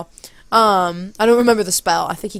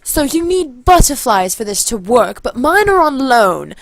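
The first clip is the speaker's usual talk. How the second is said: higher, louder stagey announcement; performing to crowd; 4 semitones higher, 6 dB louder, slower than usual. Retold or imitated. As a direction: present your move grandly to an audience. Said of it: "so I was like".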